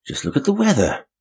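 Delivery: high-pitched protest. A narrator's reading. Young man replies to Janet about the snow, protesting.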